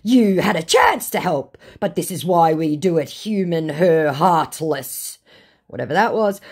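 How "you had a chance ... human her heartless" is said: louder, higher, halting mock reproach; hammed-up accusation; reproachful scammer. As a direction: scold as the scammer, slowing on the last words.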